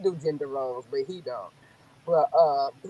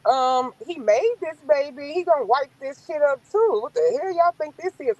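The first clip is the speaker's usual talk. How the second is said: indignant retort; 8 semitones higher than usual. Retold or imitated. Wife telling Southern folks the father cleans up too, indignant. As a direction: sound outraged at the double standard. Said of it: "I'd be like".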